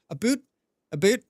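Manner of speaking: "A boot?" said like Canadian "about", rising; mimicking Canadian "a boot". Shown as a demonstration. Streamer echoes a Canadian saying "a boot", imitating. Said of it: "did I hear a boot in there".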